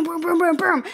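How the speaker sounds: high, punchy booms; gunshot noises. Retold or imitated. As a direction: spit out the shots like a gun. Imitating gunshots in the basement.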